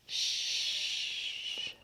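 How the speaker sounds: whispered shh; shushing. A narrator's reading. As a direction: hush them with a breathy shh. Secret present wrapping.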